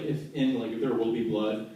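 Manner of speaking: usual speaking voice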